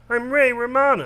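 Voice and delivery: high put-on sitcom-comedian voice; Ray Romano. Shown as a demonstration. Showing friend the voice morph, high comedian impression. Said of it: "Turns into Ray Romano".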